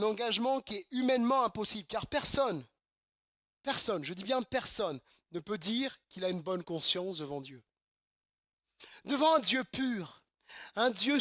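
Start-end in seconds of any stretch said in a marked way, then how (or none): none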